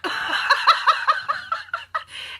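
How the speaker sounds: airy high-pitched laugh; high breathy laugh. Real, not acted. Telling the duck story, high airy laugh.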